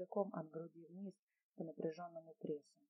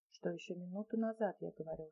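plain reading voice

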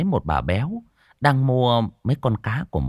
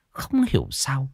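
usual speaking voice

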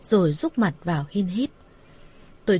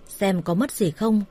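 ordinary delivery

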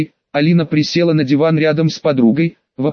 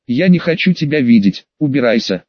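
ordinary delivery

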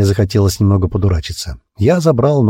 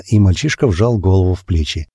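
usual speaking voice

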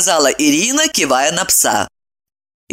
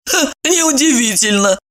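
smirking; 7 semitones higher than usual. Acted. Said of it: "усмехнулась Ирина".

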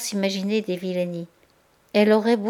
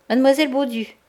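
stern and raised; solemn summons; 5 semitones higher, 5 dB louder, faster than usual. A narrator's reading. Madame Aurélie calling Denise, solemn and stern. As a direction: announce her name like a verdict. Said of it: "à l'air solennel".

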